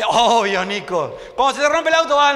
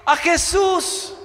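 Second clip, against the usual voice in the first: call and response; 4 semitones higher, much slower than usual. Real, not acted. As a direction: lead the crowd to shout it back. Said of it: "Quiero que lo diga fuerte".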